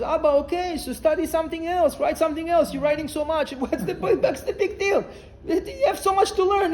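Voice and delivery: high, insistent coaxing with a laugh in it; pleading son. Retold or imitated. Son to crying father over a missing book, pleading. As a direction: imitate a son urgently reasoning with his father.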